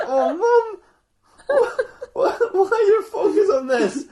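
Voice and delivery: very high, loud, laughing stammer; mock exasperated wail. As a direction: whine at Mum while cracking up. [name] despairs at his mum.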